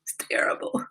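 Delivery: soft, voiceless murmur; aghast whisper. Real, not acted. Owner lamenting her puppy's fall, aghast whisper. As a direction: whisper it in dismay.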